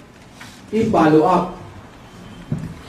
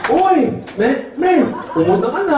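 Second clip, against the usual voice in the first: high mock nasal greeting; excited ngongo hailing a pal; ngongo son; 5 semitones higher than usual. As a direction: imitate garbled cleft-palate speech, pitched up. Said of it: "Pagpunta ng anak ng ngongo".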